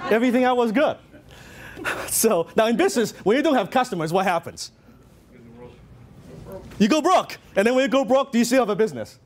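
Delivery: mock cheerful. Real, not acted. Joking about a failed business.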